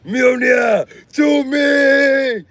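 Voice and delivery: high, slow drawn-out shout; mock summoning shout. Mechanic summoning his hammer like Thor, long high shout. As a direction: call the hammer to your hand.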